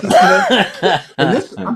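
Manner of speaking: laughing through the words. Real, not acted. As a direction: chuckle while telling it. Laughing at the Kleenex waving.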